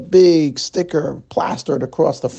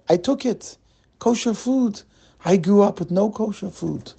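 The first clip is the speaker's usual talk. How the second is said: put-on higher-pitched, broken English with a light Russian accent; apologetic Russian-accented stammer; 5 semitones higher than usual. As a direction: play the eager Russian immigrant in a light Russian accent.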